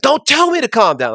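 high, mock indignant yelp; angry customer. Worker imitates an upset customer told to calm down, mock indignant. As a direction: squeal it like an offended customer.

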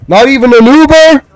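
outraged shout; indignant outburst. Real, not acted. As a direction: protest the rip-off out loud. Terminal offers leaving the facility.

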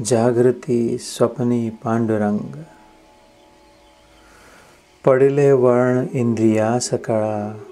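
measured abhang recitation with drawn-out line endings; sustained, prayerful verse delivery; devotional verse recitation. Acted. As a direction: recite the saint's poem reverently, line by line.

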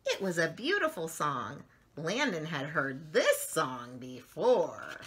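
slow, gentle storybook reading; lively picture-book narration; reading to children. A narrator's reading. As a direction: read it like bedtime story time for little ones.

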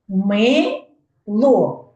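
"Мило" split into two beats; syllable by syllable. Shown as a demonstration. Teacher asking for syllable count, "Мило" syllable by syllable. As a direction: pause between Ми and ло. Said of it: "Скільки складів".

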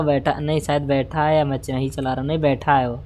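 usual speaking voice

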